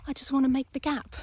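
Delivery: mildly whiny inner monologue; impatient driver's thought. Acted. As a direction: voice an anxious driver willing a gap. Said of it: "you're like".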